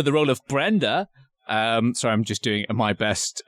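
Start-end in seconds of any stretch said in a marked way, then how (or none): none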